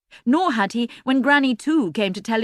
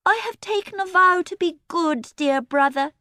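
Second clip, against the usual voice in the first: put-on pious girl voice; 10 semitones higher than usual. A narrator's reading. Pious saintly Emily.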